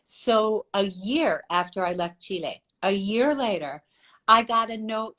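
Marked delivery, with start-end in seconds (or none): none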